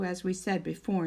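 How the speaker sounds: ordinary delivery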